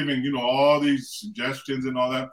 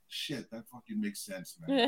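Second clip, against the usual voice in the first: much softer, under-the-breath admission; hushed realisation; 5 semitones higher, 12 dB quieter than usual. Retold or imitated. Husband realising his wife makes sense, hushed. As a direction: drop to a very quiet, sheepish concession. Retold private realisation to himself.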